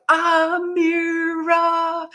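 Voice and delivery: long, high, drawn-out call; villagers' shout. Retold or imitated. Villagers calling her name as she passes, long sustained shout. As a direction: call the name out, stretching every syllable. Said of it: "people would shout".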